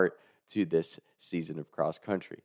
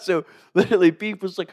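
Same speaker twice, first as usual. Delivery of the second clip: ordinary delivery, nothing marked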